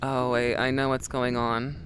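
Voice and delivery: ordinary talk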